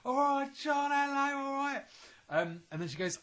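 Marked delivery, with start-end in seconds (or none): 0.0-1.8 high, loud chummy greeting; imitating Michelle. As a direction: call out to an old mate, pitched up. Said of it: "she was like".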